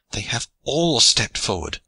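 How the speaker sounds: ordinary delivery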